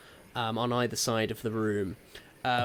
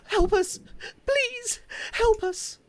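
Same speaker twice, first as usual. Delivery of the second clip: very high, loud cries for help; high-pitched damsel in distress; terrified pleading; 4 dB louder than usual. Acted. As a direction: beg like a captive young woman. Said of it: "these two pleading".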